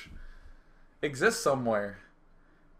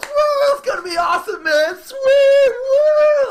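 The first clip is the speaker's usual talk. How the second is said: very high, loud whooping; pumped-up cheering; 13 dB louder, slower than usual. Retold or imitated. Red Bull Air Force hyping the jumper, pumped-up high shouting. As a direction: shriek it like a hyped-up stunt crew. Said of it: "getting all pumped up".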